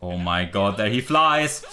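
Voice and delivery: normal talking voice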